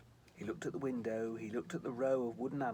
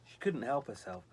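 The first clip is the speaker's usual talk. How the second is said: ordinary delivery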